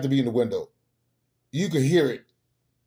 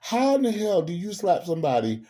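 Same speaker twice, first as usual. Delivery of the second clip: usual speaking voice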